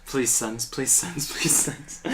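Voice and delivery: repeated little mock prayer; mock pleading. Dreading a daughter, mock pleading for sons. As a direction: beg fate, half joking.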